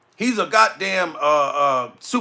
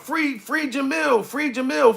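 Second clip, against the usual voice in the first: higher, slowed mock rallying cry; imitating supporters; 7 semitones higher, 3 dB quieter than usual. Imagining people calling for his release from jail, mock supporters' chant. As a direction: mimic people campaigning for a jailed friend. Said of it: "motherfuckers talking about".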